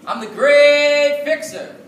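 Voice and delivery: long, drawn-out high booming call; town crier call. Acted. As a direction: belt it out like a market crier. Street crier calling to town.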